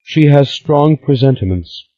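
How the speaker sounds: put-on high, theatrical young woman; a melodramatic sister, sighing. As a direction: sigh it out like a drama queen. Stella's voice.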